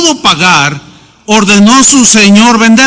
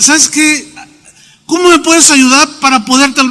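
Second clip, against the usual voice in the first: higher, begging voice of a crying debtor; pleading debtor; 6 semitones higher than usual. Acted. A crying debtor begs his creditor, in a higher, pleading voice. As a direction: play the desperate man pleading for help.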